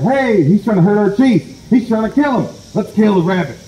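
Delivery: high-pitched, urgent rallying call; outraged mob cry. Acted. Animals defending their chief, outraged.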